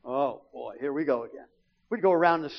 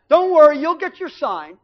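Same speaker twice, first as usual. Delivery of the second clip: loud teasing promise; 9 semitones higher, 11 dB louder than usual. Retold or imitated. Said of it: "my dad puts that trip on her".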